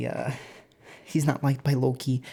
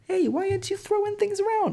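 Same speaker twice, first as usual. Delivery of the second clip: put-on very high, sweet old lady falsetto. Loki as old lady coaxes blind Hoder, high falsetto granny. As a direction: croon it like a kindly granny. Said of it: "still as an old lady".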